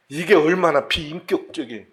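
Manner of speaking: ordinary talk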